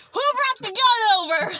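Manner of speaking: high, loud, slow mock disbelief; imitating a startled mother; shocked mom. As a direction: play the mom catching on in alarm. Mom's shocked question, retold.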